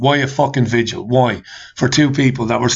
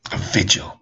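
breathy, much lower sneer; disdainful hush; scornful whisper; 6 semitones lower, 5 dB quieter than usual. Real, not acted. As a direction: spit it out with contempt. Scoffing at the planned vigil.

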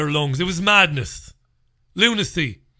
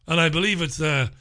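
ordinary talk

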